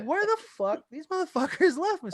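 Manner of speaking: high-pitched disbelief at being ditched; shocked disbelief. Retold or imitated. Left alone on Fremont, high incredulous outburst at friends. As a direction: squeal it out, stunned and betrayed. Abandoned outside, retold outrage.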